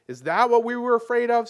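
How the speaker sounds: high, loud sneering question; mock the fallen foe; scornful jeer. A narrator's reading. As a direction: another follower sneering at the bound lion. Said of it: "said another".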